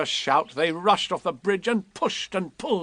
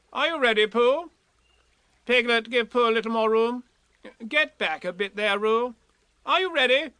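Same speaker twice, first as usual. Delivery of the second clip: higher, bossy little Rabbit voice; Rabbit taking command; 9 semitones higher than usual. A narrator's reading. Rabbit ordering Pooh and Eeyore at the bridge, high and bossy. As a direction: give orders like a fussy organiser.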